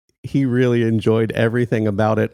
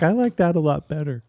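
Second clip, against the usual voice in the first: put-on kid voice; 9 semitones higher than usual. Son's relieved answer.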